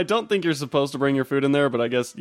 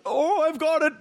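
high, badly faked Finnish accent; a silly Finnish executive with a bright idea; fake Finnish accent. Imitating a Finnish executive pitching an idea, in a fake Finnish accent. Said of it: "I don't know how Finnish people sound".

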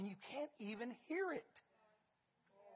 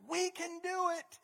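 higher, slow confident cry; 7 semitones higher than usual. Retold or imitated. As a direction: declare it boldly. Caleb and Joshua quoted.

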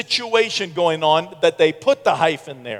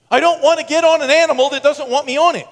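high, loud protest; emphatic refusal; 9 semitones higher, 5 dB louder, faster than usual. Retold or imitated. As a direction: refuse outright, pitched way up and loud. Protesting to his bull-riding friend.

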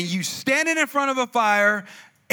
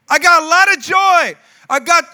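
loud, high exuberant shout; hammed-up church joy; 7 semitones higher, 10 dB louder than usual. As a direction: burst out in mock-zealous cheer. Mimics Sunday church enthusiasm.

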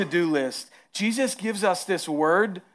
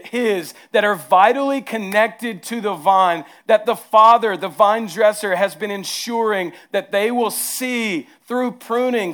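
preaching; 4 semitones higher, 5 dB louder than usual. Acted. Pastor preaching to his church about the vine, louder and higher. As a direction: preach it out to the congregation.

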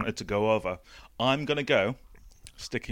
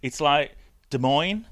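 usual speaking voice

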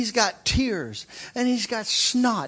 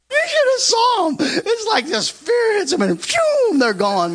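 high, loud squeal through real laughter; laughing hard; 8 semitones higher, 7 dB louder than usual. Real, not acted. Preacher telling how security fled, helpless laughter. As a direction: crack up while telling it, voice shooting up.

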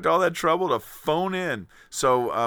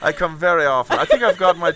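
a light put-on English accent; playing a posh Englishman called Tony; 4 semitones higher than usual. Guest interviewed on the show in a light English accent. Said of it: "I've got my stride now with this accent".

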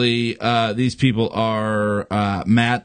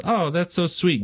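sweet angel; 6 semitones higher than usual. Acted. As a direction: coo it, charmed and gentle. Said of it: "Angel says".

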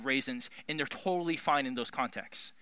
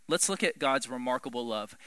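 ordinary delivery

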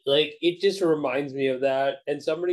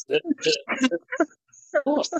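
shocked. Real, not acted. Stunned stammer, high and far.

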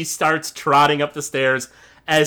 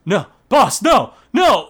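higher, louder panicked orc minion; scared orc pleading; 8 semitones higher, 7 dB louder than usual. Acted. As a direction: beg for your life, frightened. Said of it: "one of the orcs go".

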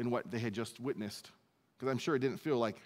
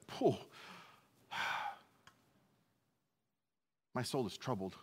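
heavy exhale, then softer troubled words; troubled sigh; 5 dB quieter than usual. Retold or imitated. Jesus looking at his coming death, troubled sigh. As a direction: let out a long breath before speaking.

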